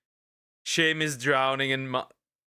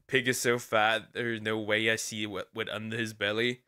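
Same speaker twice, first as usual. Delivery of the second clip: ordinary talk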